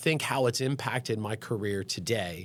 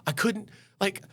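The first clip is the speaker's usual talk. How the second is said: high-pitched, halting retelling of a crying man; mock-emotional, stammering plea; imitated tearful outpouring; 10 semitones higher than usual. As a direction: act out a patient overcome, voice pitched up. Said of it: "starts hysterically crying. Like a baby".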